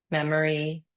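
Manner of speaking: ordinary talk, nothing marked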